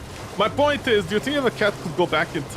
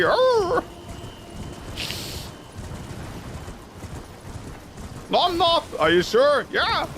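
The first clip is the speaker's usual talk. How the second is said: squeaky, much higher playful pet voice; high wolf voice; 12 semitones higher than usual. Pretend. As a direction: play the wolf denying it's bored. Playful wolf back-and-forth.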